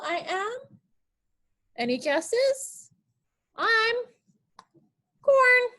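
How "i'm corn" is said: high bright reveal of the answer. Pretend. Teacher squeaks the answer to the kids. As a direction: pop up high to announce the answer. Teacher voices the guessed vegetable.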